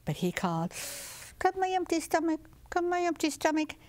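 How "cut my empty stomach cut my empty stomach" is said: high summoning call; calling his stomach. Acted. Rooster in the beehive calling his empty stomach, high call. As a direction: sing out to the stomach.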